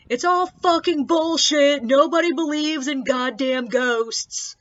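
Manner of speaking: put-on loud, slow, high drunken yell; drunk frat bro yelling. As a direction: bellow it like a drunk heckler. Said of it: "and screamed".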